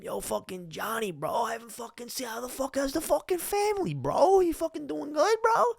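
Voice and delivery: high, exaggerated Long Island bro accent; mock Long Island bro. Classmate greeting him at a bar, hammed-up Long Island accent. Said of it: "speak in this voice".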